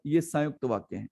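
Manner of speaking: usual speaking voice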